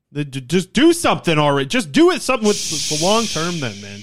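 fed-up yell at management to just act; frustrated shouting rant; exasperated rant. Real, not acted. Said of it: "I've been yelling all the time".

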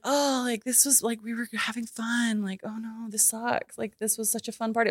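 sulky, soft complaint; whiny friend. Retold or imitated. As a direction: friend mourning the ruined party. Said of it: "my friend was like".

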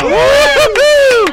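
very high loud whoop; excited whoop. Acted. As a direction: cheer in triumph. Lever comes down.

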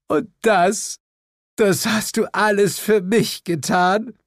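high-pitched, gushing wonder; deeply moved Lilith. A narrator's reading. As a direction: ask it high, overcome with emotion. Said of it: "blutige Tränen schimmerten in ihren Augen". (hoch und zu Tränen gerührt)

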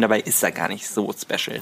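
normal talking voice

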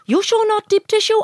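high-pitched imitation of the masseuse. Masseuse double-checking with client, high mimicked voice. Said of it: "And she goes".

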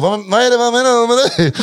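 lightly mock a puzzled comic; Adde Malmberg impression. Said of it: "Han bara".

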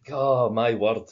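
normal talking voice

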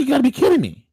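high, quick disbelief; can't believe what you heard; incredulous. Retold or imitated. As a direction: scoff in high-pitched disbelief. His own disbelief in retelling.

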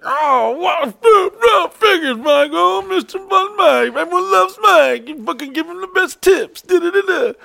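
very high, loud mock shriek; salty outburst. Roommate shrieking about Mike's bigger tips, loud and high. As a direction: shriek it in jealous outrage. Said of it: "He gets so salty".

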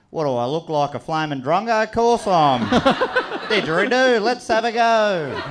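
loud, high, broad Australian ocker voice; ocker Aussie girl. Retold or imitated. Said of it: "She would have said".

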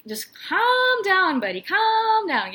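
high, drawn-out mock soothing; exasperated onlooker. Onlooker to an over-loyal friend, exasperated calming down. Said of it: "everyone else is gonna look at us like".

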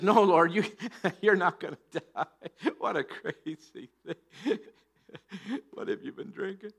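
words broken up by laughter; laughing rebuke. Acted. Preacher as Peter taking Jesus aside, laughing rebuke. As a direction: play an incredulous objection through chuckles.

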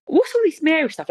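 high, baffled question; can't believe it, pitch up; incredulous. Real, not acted. Reacting to Mary nicknames.

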